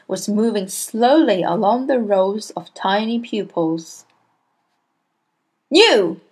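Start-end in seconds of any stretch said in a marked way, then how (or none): 5.7-6.2 louder, higher bark. A narrator's reading. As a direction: jab the word at the boy. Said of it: "she barked".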